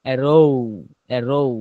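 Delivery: arrow ending pushed toward a long o, A row; drawn-out o sound. Shown as a demonstration. Teacher showing students how arrow ends, with a long o. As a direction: stretch the last vowel of a row into o. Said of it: "ও এর দিকে ঠেলে চলে যাব".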